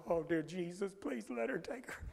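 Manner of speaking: hushed desperate prayer. Retold or imitated. Congregation praying the child gets taken out, hushed mock-desperate prayer.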